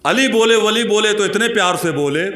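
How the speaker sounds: high, projected declamation; Josheela शेर-पाठ. Acted. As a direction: launch the verse high, easing down at the end. वही शेर जोश से. (ऊँचे सुर में जोश से शेर पढ़ना)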